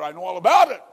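sudden loud preaching cry; impassioned shout. Real, not acted. Preacher telling a friend he knows all about sin, impassioned shout. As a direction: burst out at full pulpit volume. Preacher cries out his testimony.

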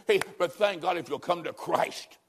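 ordinary delivery

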